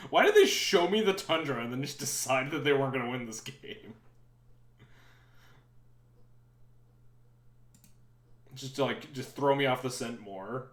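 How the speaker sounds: loud mock-indignant sarcasm; sarcastic. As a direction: rant sarcastically, loudly. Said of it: "I'm completely being sarcastic".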